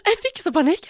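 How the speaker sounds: ordinary talk, nothing marked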